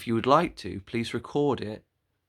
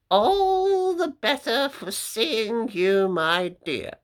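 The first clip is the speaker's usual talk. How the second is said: high, louder put-on granny voice; sly wolf granny; 12 semitones higher, 7 dB louder, slower than usual. Wolf in disguise answers the girl, high louder fake granny voice. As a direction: answer sweetly with a sly edge.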